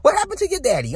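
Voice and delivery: mock the guy in a squeaky high register; sarcastic high-pitched ribbing. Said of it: "talking like this".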